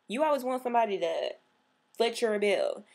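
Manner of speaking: usual speaking voice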